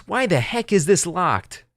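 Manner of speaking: narrator's mild imitation of a screaming customer; mock scream. A narrator's reading. Customer stuck at the locked door, mock screaming. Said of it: "She looks at me and screams".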